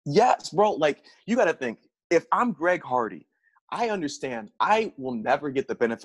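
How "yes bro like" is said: ordinary delivery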